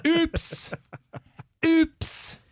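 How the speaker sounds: high, sing-song mock oops. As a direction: tease the blunder. Mock embarrassment at gaffe.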